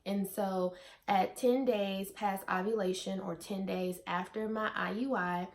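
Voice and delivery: normal talking voice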